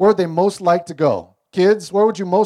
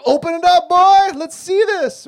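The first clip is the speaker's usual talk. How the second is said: much higher, loud mock-sinister voice; gleeful cruel dad; 12 semitones higher, 6 dB louder than usual. Parent taunting son with box of centipedes, high and gleeful. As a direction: hand over the box with wicked glee.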